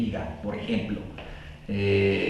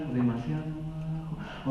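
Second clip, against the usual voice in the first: too quiet; 10 dB quieter than usual. Shown as a demonstration. Speaker demonstrating a too-soft voice to the audience, much softer. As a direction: say "o demasiado bajo" much softer. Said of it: "el tono de tu voz es demasiado al".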